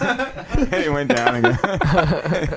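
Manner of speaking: giggling through the words; laughing. Real, not acted. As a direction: laugh while telling it. Laughing through funny story.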